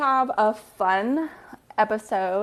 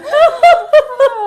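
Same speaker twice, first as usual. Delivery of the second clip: loud, very high cackle; real high, loud laugh; burst of laughter; 13 dB louder than usual. Real, not acted. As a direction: shriek with laughter. Laughing over the prank.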